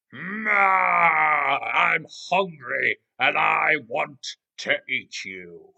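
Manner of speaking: loud, slow roaring crocodile; hungry crocodile. Acted. Crocodile to tiger he wants to eat, loud roaring threat. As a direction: open with a big roar, then drag out the menace. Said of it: "Now, the crocodile comes out of the forest".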